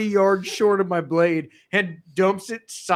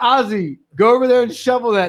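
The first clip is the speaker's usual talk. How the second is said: foreman's order; 5 semitones higher, 5 dB louder than usual. Retold or imitated.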